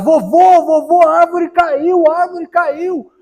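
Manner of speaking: high, loud, drawn-out cry for grandpa; desperate shout. Acted. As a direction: shout in alarm like a desperate kid. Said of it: "começa a gritar desesperado". (grito desesperado)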